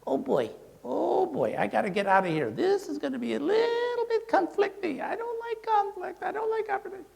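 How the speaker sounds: high, fretful voice of a nervous dog; a conflict-averse dog panics at the meeting in a high nervous voice; anxious dog voice. Retold or imitated. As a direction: squeak out the worry like a scared pup. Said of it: "all the dogs are gonna go".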